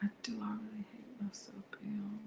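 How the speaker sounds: hushed, voiceless mocking echo; mock whisper. Wife mockingly whispering back to Bill.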